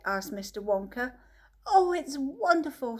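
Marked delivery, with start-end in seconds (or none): 1.7-3.0 delighted Charlie. A narrator's reading. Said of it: "said Charlie".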